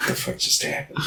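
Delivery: soft, breathy whisper of disbelief; stunned whisper. Retold or imitated. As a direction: whisper it like you can't believe it. Stunned reaction after shocking ending.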